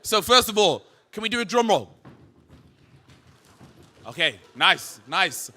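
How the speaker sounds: shouting, pumping up the crowd; big booming hype voice; hyped crowd warm up. Real, not acted. Hypes crowd before speaker intro.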